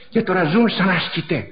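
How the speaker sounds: usual speaking voice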